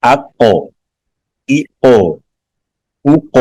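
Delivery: "اق" with a bouncing echo on the final q; qalqalah demonstratie. Shown as a demonstration. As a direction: sound the q in "اق" hard, then let it rebound. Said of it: "حروف قلقلہ تھے".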